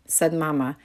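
ordinary delivery, nothing marked

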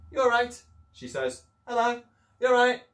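put-on high, loud girl's voice; lightly voiced cheerful girl. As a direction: chirp it out as the little girl.

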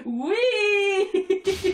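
high, laughing whee; high playful cheer; gleeful squeal. Real, not acted. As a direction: giggle into a long delighted squeal. Delighted at cute taunt.

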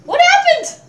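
high, loud cry of confusion; real high-pitched outburst; startled yell. Real, not acted. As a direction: shout it in bewilderment. Bewildered by sudden game event.

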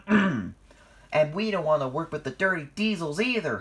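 haughty, heated retort; huffed. A narrator's reading. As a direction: huff it back, offended. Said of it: "huffed James".

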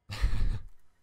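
a long, loud weary sigh; heavy sigh. Acted. Alien weighed down by the empire, heavy loud sigh. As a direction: let out a heavy sigh of regret.

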